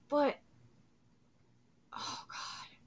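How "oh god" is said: whisper. Real, not acted. Horrified reaction while watching.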